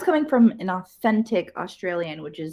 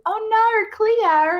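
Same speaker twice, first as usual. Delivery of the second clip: high, louder mock-Australian wail; exaggerated Australian accent; 12 semitones higher, 5 dB louder, much slower than usual. Quoting girls doing a skit, exaggerated Australian accent. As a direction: mimic an over-the-top Aussie accent. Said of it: "they were exaggerating the accent".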